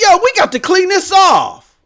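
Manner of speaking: high-pitched wife demanding action; high-voiced retold demand; imitated insistent wife. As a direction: act out a wife giving orders. Wife's demand acted out.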